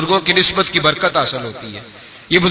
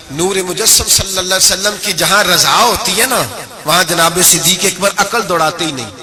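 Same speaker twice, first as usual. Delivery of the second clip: ordinary talk, nothing marked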